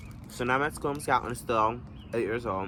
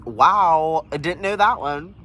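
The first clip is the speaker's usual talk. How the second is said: mock amazement; 3 semitones higher, 7 dB louder, slower than usual.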